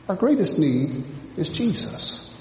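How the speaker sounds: ordinary talk, nothing marked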